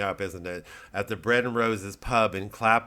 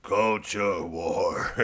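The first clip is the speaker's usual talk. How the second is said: dramatic announcement; 3 semitones lower, much slower than usual. Pretend. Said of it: "working on a character called".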